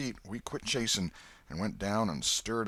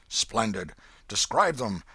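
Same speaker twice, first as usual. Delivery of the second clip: eager; 6 dB louder than usual. A narrator's reading. Eager old man urging.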